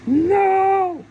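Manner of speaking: high, loud, drawn-out cry of refusal; shouted refusal. Retold or imitated. Voicing the huge refusal from beyond cosmos, shouted. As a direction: belt out one long forbidding word.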